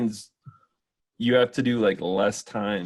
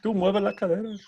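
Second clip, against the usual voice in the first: teasing; 11 semitones higher than usual. Acted. Teasing in a high voice.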